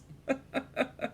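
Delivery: high staccato giggle; amused run of high ha-has; laughing. Real, not acted. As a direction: burst of high, chuckling laughter. Laughing at own admission.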